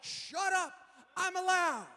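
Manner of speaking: put-on high, yelled teenage comeback; mock indignant brother; retold sibling retort. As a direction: yell back at a nagging sister. Retold sibling quarrel with sister.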